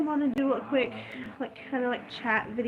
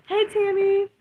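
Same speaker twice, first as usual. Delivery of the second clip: bright greeting called out; 8 semitones higher, 5 dB louder than usual. Real, not acted. Greeting someone who came in.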